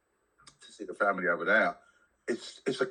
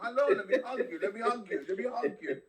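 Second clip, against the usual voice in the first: mock hugger; 12 semitones higher than usual. Mocking his co-host's tale of hugging strangers, hammed-up and very high, fading. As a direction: squeal out the hugs then let them trail off. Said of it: "Mass hugging".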